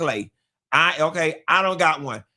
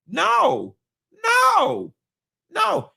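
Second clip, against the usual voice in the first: emphatic no; 11 semitones higher, much slower than usual. Real, not acted. Emphatic refusal.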